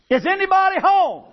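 high-pitched calling into the dark; hollering out. Retold or imitated. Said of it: "he hollers out in the dark".

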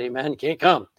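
ordinary talk

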